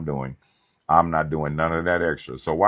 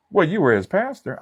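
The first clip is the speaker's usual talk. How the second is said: high, loud mock family member; 14 semitones higher, 6 dB louder than usual.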